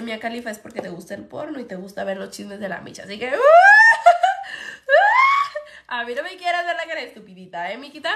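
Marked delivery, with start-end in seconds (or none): 3.4-5.6 loud, high burst of laughter; loud cackle. Real, not acted. Cackling at a viewer who told her to block her. Big laugh after insult.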